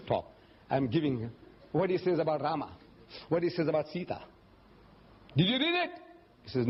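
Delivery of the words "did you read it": high-pitched retold challenge; challenging question. Speaker challenges an audience member about the book, high questioning. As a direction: throw the question up high at the listener.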